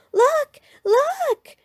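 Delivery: high, loud excited cub cry; excited shout. A narrator's reading. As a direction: yell it out thrilled at the new ride. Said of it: "shouted Sister".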